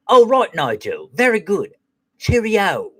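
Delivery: high, drawn-out posh British voice; posh British accent. Retold or imitated. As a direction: imitate a posh British phone manner. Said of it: "like, this posh British, British accent".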